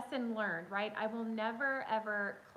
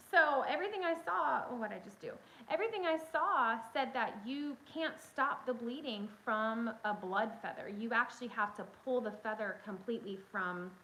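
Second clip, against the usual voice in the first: ordinary delivery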